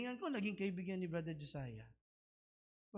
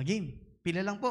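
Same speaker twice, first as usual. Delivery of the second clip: ordinary talk, nothing marked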